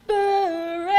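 huge, long-held chanted bellow; high, very loud, sustained divine cry; thunderous heavenly call. A narrator's reading. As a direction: boom it out as if shaking the world's roots. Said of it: "a voice call out loud enough to shake the very roots of the world".

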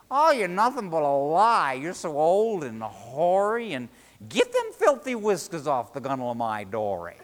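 loud, scornful tirade; angry insults. A narrator's reading. Fisherman ordering the serpent off his dory, angry. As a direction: hurl the insults at full voice.

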